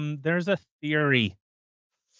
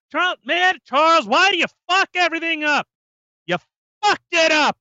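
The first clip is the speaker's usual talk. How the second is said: high furious mock shout, much louder; angry yell; 13 semitones higher, 6 dB louder than usual. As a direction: scream at him in mock rage. Yelling at absent Charles.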